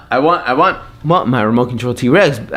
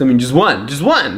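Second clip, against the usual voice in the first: usual speaking voice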